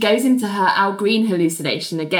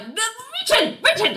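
mock nervous protest; 14 semitones higher than usual. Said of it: "starts giggling nervously to protest".

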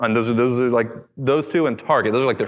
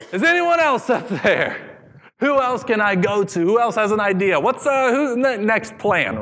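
crying out; 9 semitones higher than usual. Retold or imitated. Listener's reluctant reply mocked.

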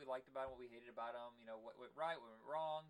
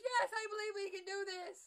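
much higher, louder hammed-up cheer; kid's excited answer; 9 dB louder than usual. Little boy answering his dad in the trailer, excited mock cheer. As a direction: shout it back like an eager little boy. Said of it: "And he goes".

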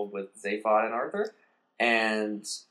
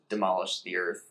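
ordinary delivery

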